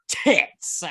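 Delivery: Tits spat out high and hard; hammed-up, over-enunciated Tits, teasing a friend; mock aggressive. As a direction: hit Tits with exaggerated attack. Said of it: "You say it so aggressively".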